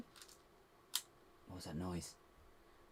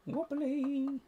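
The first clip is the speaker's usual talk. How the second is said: high squeal of wobbly; 4 dB louder than usual. Real, not acted. Exclaims at the shaky build.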